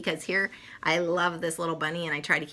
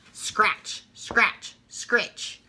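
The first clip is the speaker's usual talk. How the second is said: whispered scratchy hoe noise; hoe scraping; 4 semitones higher, much slower than usual. Acted. Gardener hoeing onions, whispered scratching noise. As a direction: rasp out the scraping sound. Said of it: "noise of a hoe".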